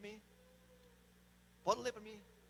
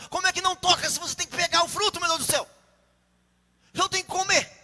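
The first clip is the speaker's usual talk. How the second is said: high, raised, incredulous arguing; exasperated; 7 semitones higher, 8 dB louder, faster than usual. Real, not acted. Preacher arguing that fruit must be touched, exasperated protest. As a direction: protest the obvious, voice pushed up.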